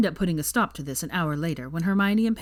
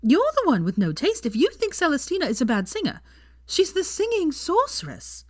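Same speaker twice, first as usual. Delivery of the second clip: indignant defense; 11 semitones higher, 3 dB louder than usual. A narrator's reading. Offended Pansy defending singer.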